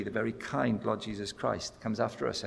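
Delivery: usual speaking voice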